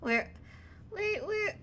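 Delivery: put-on a lost kid looking around; small confused child calling out; Rad the kid. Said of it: "he's looking around like".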